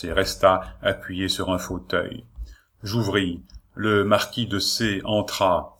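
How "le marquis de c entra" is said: usual speaking voice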